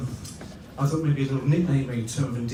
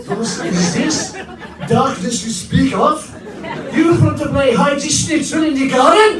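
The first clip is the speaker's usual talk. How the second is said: high, loud, slow hammed-up German voice; mock German accent; 13 semitones higher, 9 dB louder, slower than usual. German partner answering the bins request, hammed-up German accent. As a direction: play the shocked German partner, big and silly. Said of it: "I said to her".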